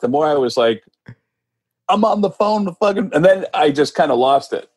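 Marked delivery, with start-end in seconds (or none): 1.9-3.1 high, pinched inner exclamation; giddy disbelief. Retold or imitated. Fan realising he's phoning [name], giddy disbelief. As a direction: marvel in a raised voice. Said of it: "the more I heard [name] talk".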